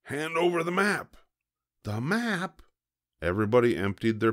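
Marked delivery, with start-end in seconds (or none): 0.0-1.2 high-pitched captain's demand; demanding pirate. A narrator's reading. 1.8-2.6 puzzled echo. A narrator's reading. Someone echoes, puzzled.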